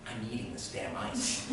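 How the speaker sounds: quiet, resolute muttering; inner voice. Retold or imitated. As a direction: say it under your breath to yourself. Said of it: "I say in my mind".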